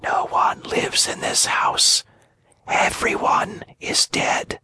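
put-on barely audible, like a dying girl; weak whisper. A narrator's reading. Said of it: "With a voice so weak that it could hardly be heard, she whispered".

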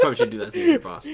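high and fast through laughter; giggling disbelief; laughing. Real, not acted. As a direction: squeak it out while cracking up. Laughing at own joke.

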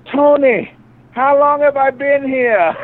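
high, loud, slow put-on call; mock yell to Tony. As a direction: call across the bar like the waitress. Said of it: "she literally yells to the guy".

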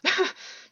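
high breathless despairing laugh; bitter unhinged laugh. A narrator's reading. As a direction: laugh from the edge of breaking. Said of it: "and then laughed".

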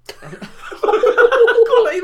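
loud high cackling laugh; burst of laughter. Real, not acted. Telling podcast co-host about dad, bursting out laughing. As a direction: crack up hard at the punchline.

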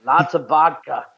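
proud cartoon Russian accent; mock Russian accent. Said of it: "I don't have a Russian accent".

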